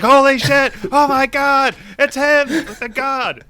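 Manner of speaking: very high, loud hyped screaming; wrestling-fan freakout. Retold or imitated. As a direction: lose it like a wrestling crowd at a surprise entrance. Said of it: "like the Stone Cold Steve Austin".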